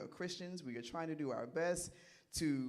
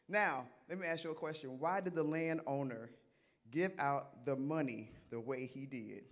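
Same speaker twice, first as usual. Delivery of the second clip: usual speaking voice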